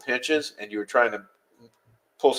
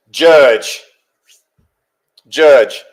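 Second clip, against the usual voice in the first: loud; 9 dB louder than usual. Real, not acted. Calling out to the judge.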